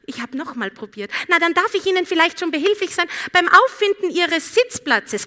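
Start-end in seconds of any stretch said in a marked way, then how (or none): 1.3-5.3 high, sugary overly polite offer; polite service voice; sweet flight attendant. Retold or imitated. Attendant tries again politely.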